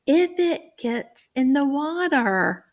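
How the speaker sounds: teacher question voice. Shown as a demonstration. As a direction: ask it brightly. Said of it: "And then I'll say".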